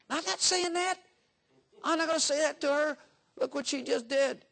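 high, indignant whine of a stubborn husband; whiny refusing husband. Pretend. Husband refusing God's advice, high indignant whine. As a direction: protest like a sulking boy.

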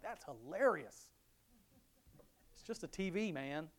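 amused retort. Retold or imitated. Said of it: "I'm like".

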